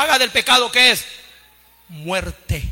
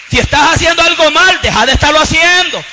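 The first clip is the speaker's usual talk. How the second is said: sudden loud stern exhortation; loud warning; 5 semitones higher, 7 dB louder than usual. Real, not acted. Pastor warning sinners to stop, loud and stern. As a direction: bark the command at the pews.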